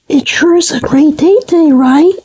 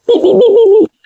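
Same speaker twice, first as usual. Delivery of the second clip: short rapid burst of beeps; imitated beeping; 3 semitones higher, much faster than usual. While brainstorming house names, imitated beeps. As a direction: beep quickly like a machine.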